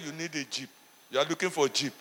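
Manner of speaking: low, soft teasing jab at the wife. Retold or imitated. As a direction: tease her gently, voice dropped low. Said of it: "I was teasing my wife".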